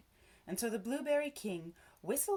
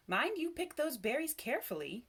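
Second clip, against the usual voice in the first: sharp, higher-pitched caution; warning; 6 semitones higher than usual. A narrator's reading. Missus Cranberry to children picking berries, sharp warning. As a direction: warn firmly, voice raised in pitch. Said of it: "warned Missus Cranberry".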